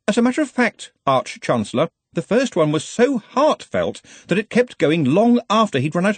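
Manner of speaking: high-pitched, insistent subordinate; earnest, fussy assistant. Acted. Ponder reports.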